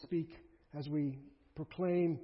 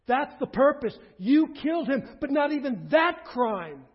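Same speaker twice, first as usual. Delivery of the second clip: impassioned preaching; 3 semitones higher, 9 dB louder than usual. Acted. Preacher assuring listeners that even murdering Christ is forgivable, impassioned preaching. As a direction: build to a loud, raised pulpit cry.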